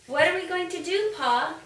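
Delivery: put-on a small child pleading for an answer; anxious child. A child asking Pa, anxious and quick.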